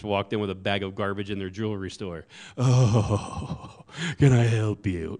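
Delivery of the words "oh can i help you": mock British accent, louder and sniffy; a British clerk greeting an unwelcome American; snooty British shopkeeper. Comedian's British shopkeeper sneering at an American, British accent. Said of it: "a British person, when they see an American".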